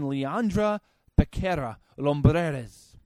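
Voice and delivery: put-on Spanish-accented names, rolled and lively. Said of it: "you like my Spanish accent there".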